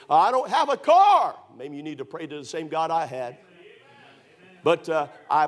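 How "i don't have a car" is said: high, loud, slow whine of I don't have a car; whiny complaint. Retold or imitated. Bible college kids complaining to the preacher, whining. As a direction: mimic a complaining student. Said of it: "kids come to Bible college here and say".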